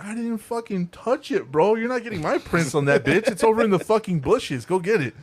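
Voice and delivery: high-pitched, incredulous refusal; retold exasperated outburst; indignant protest. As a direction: protest in disbelief, pitch jumping up. Retelling indignant refusal to cop.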